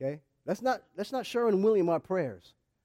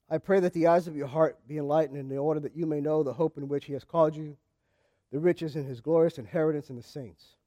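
calm, lowered reading of a prayer; scripture reading; 8 semitones lower than usual. Acted. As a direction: read the verse prayerfully. Reads scripture prayer aloud.

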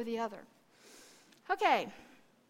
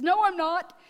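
loud, higher mock contradiction; twelve-year-old's retort; 6 semitones higher, 11 dB louder than usual. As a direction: imitate a girl instantly protesting to her father. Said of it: "she immediately, being a typical twelve-year-old".

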